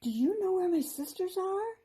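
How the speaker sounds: high, eager fairy voice; bursting with sudden hope; excited. Acted. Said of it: "Sky looked up excitedly".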